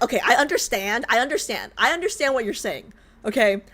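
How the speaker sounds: ordinary talk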